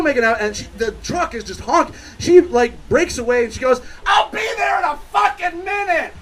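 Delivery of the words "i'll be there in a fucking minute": very high imitated yell; mock irritated shout. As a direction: shout back at a honking truck, hammed-up. She breaks away, yells at truck.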